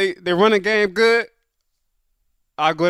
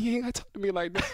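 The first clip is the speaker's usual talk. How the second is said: hurt mock protest; 3 semitones higher, 9 dB quieter, much faster than usual. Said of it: "I'm just telling him".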